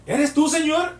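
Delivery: high, loud question cast over distance; acted calling out. Peter calls to Jesus walking on the water, calling out.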